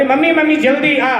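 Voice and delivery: high, eager summons; urgent calling. Acted. Bride summons mother-in-law to the churn, calling urgently. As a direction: call mummy over quickly.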